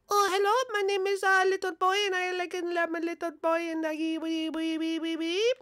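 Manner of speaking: put-on high, soft silly little boy. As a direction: play a tiny boy babbling wee wee. New character, little boy.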